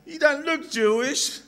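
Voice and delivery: high-pitched comic doorkeeper voice; Japanese doorkeeper. Acted. Japanese man doubting the Australian visitor, high comic voice. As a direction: deliver the punchline in a high put-on voice. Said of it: "the Japanese guy says".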